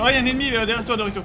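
prévenir vite d'un ennemi, voix haute; alerte excitée. Real, not acted. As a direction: appel pressé au coéquipier. (alerte pressée, voix plus haute)

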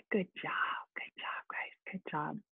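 very soft whispered good job; barely a whisper; whispered praise. Real, not acted. Teacher quietly whispering praise to students.

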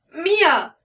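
screaming a sister's name, high and loud; desperate shout. A narrator's reading. Narrator screaming for his sister in the night, loud. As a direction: yell her name with all you have. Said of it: "brüllte ich".

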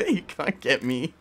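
high burst of giggling into a taunt; laughing taunt. Real, not acted. Player to the monster chasing them, laughing taunt. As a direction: laugh helplessly, then taunt through it.

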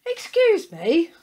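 high, loud, slow 'excuse me'; drawn-out, high-pitched storybook dog voice; dog character calling. A narrator's reading. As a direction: pipe up to get attention. Willie politely hails a cow.

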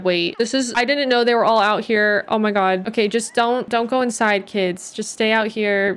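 ordinary delivery

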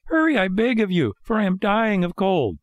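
high, urgent puppet plea; high-pitched begging marionette; pleading Pinocchio. A narrator's reading. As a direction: beg in a small high voice. Freezing puppet begs the snail.

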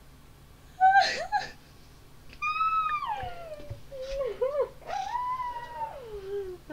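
a long, high, screaming laugh; shrieking laughter. Real, not acted. Player shrieks with laughter at the game's message. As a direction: laugh until it turns to a shriek.

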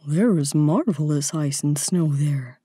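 low storybook reindeer voice; talking reindeer. A narrator's reading. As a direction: speak as a big, deep-voiced animal. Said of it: "said the reindeer".

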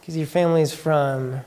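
drawn-out, hesitant mimicry; uncertain guessing. People guessing where his family is from, hesitantly mimicked. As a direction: stretch each country out as a tentative guess.

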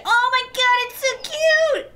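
cute squeal. Real, not acted. Player cooing over a rooster chick, high squeal. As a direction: gush over something adorable.

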